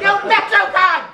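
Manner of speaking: high, exasperated mock yell; yelling from roof. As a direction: holler a correction to people below. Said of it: "I was yelling something from the roof".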